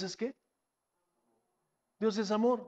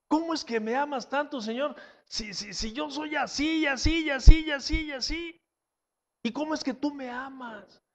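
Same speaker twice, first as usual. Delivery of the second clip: pleading believer; 5 semitones higher than usual. Acted. Believer voicing a prayer to God.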